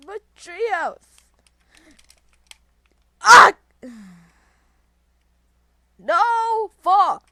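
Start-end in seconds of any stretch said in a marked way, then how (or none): 3.2-3.5 high, sudden yell; loud scream. Real, not acted. Scream during game. 6.0-7.3 high, loud outburst; frustrated yell. Real, not acted. Upset at game mishap.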